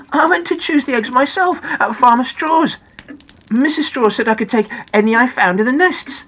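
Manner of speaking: high-pitched storybook character, earnest; Noddy, innocently protesting. Acted. Noddy explains the eggs to Big Ears, high and earnest. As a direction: explain yourself in a high little voice.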